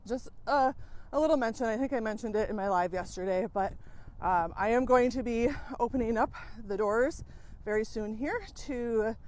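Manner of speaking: ordinary talk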